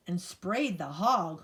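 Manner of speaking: usual speaking voice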